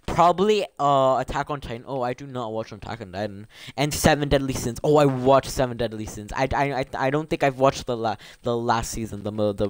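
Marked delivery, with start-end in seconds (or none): none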